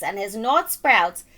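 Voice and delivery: plain reading voice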